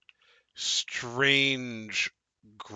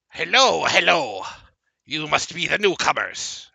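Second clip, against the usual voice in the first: high, loud squeaky little creature voice; creature greeting; 6 semitones higher, 7 dB louder than usual. Acted. GM voicing a small bat-eared creature greeting newcomers, high and loud. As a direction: greet them like an eager little imp.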